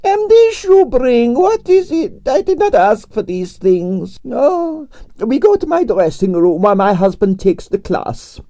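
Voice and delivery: put-on high, theatrical Russian accent with drawn-out vowels; grand Russian ballerina complaining about her night; Russian prima ballerina. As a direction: play the dramatic Russian diva. Arienskaya held voice.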